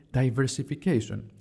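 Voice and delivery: ordinary talk, nothing marked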